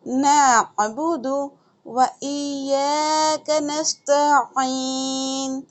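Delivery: slow chanted नअबुदु व इय्याका नस्तईन; Quran recitation. Shown as a demonstration. Teacher reciting for students, slow and melodic. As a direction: recite the verse slowly and melodically.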